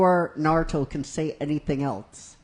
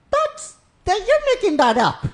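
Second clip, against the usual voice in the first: much higher, disbelieving protest; high incredulous Naruto; 6 dB louder than usual. Retold or imitated. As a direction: act out Naruto not buying it. Naruto protests in retold scene.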